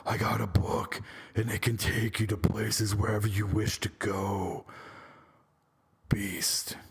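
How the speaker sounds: put-on monster voice, fading to a whisper on the last word; Beast voice. Reviewer parodying the Beast's magic book offer, Beast voice. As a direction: play the Beast, ending in a hushed whisper. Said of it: "That's my Beast voice".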